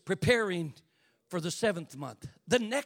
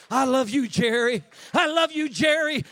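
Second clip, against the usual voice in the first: louder, lightly voiced Jesus speaking tenderly; 4 semitones higher, 7 dB louder than usual. Jesus telling the preacher Jerry he loves him, lightly voiced and louder. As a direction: speak as Jesus to his child. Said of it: "I'll hear the voice of Jesus".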